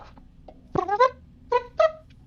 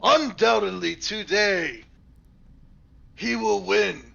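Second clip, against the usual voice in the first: proclaiming bard; 5 semitones higher than usual. Acted. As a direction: announce the champion grandly. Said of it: "I tell him".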